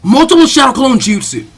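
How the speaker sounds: high, loud battle cry; jutsu shout. Acted. Naruto launching his clone jutsu, shouting. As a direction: belt out the technique.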